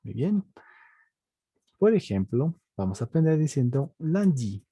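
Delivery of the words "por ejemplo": normal talking voice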